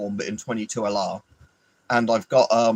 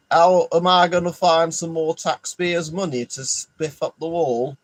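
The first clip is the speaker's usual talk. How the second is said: higher and slower put-on voice of a greedy politician; mock scheming politician; 6 semitones higher, 4 dB louder, slower than usual. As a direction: ham up a scheming minister plotting to spend. Voicing Rayner's secret plan.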